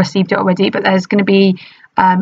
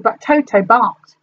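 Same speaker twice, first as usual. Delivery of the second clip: plain reading voice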